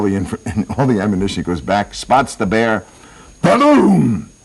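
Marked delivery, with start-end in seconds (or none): 3.4-4.3 loud, high mouthed explosion; big cartoon blast with the voice; bazooka blast imitation. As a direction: boom it out like a bazooka firing.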